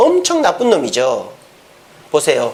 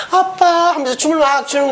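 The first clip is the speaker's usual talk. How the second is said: 살로메; 7 semitones higher than usual. Acted. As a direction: put on a wheedling little-girl lilt. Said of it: "이 살로메가 이 헤롯 안디바 앞에서". (높고 길게 끄는 애교 섞인 딸 목소리)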